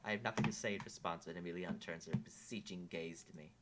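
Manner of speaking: ordinary delivery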